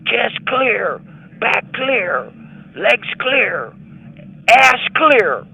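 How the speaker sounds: high clipped search calls, loudest at the end; barking officer. Retold or imitated. Officer calling out the search, high rising calls. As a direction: call off each body part like a checklist.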